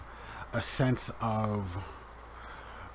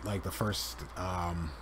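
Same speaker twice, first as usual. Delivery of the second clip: ordinary talk, nothing marked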